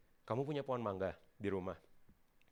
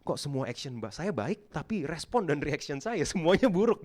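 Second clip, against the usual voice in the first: frustrated inner voice; 6 semitones higher, 10 dB louder than usual. Retold or imitated. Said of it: "kamu kayak".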